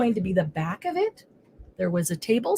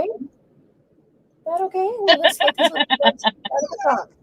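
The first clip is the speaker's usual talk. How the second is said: pet-talk; 7 semitones higher than usual. Real, not acted. Asking her dog's permission.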